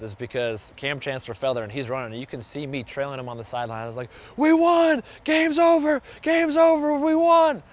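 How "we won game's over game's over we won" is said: high, loud victory yell; celebratory yelling. Retold or imitated. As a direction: shout it like the game just ended. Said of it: "that I was yelling".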